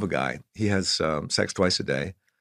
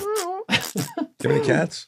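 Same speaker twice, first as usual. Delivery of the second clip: very high, slow, crying meow-like voice; mock cat voice; much slower than usual. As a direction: whine the question like a cat. Cat voice joke.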